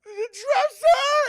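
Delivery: high, slow, loud sobbing scream of The dresser!; high crying shout; terrified scream. Retold or imitated. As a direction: wail The dresser! in terror. Scared girl points out the dresser.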